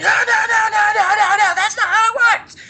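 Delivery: high, loud string of protests; frantic yelling. Retold or imitated. Orc expert stopping the animators, frantic yelling. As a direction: yell it in a comic high-pitched panic. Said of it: "I started yelling at it".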